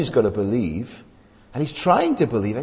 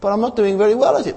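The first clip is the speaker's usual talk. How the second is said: whiny doubter; 9 semitones higher than usual. Retold or imitated. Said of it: "You say".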